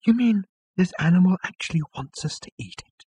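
high-pitched incredulous question; high, astonished aside; whispering Trillian. A narrator's reading. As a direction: ask in hushed disbelief, pitched high. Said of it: "whispered Trillian to Ford".

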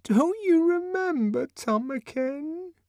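high, quavering plea that fades away; trembling voice. Acted. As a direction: let the voice shake as hope dies. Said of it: "in a voice that trembled".

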